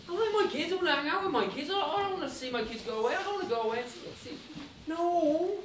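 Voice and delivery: high, mildly wailing lament; acted whiny dread. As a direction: whine it like a man pleading for his family. Acts out Hans dreading losing his family.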